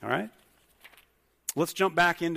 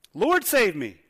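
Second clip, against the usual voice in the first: desperate cry for help; 3 semitones higher, 6 dB louder, slower than usual. Acted. Peter sinking, cries out.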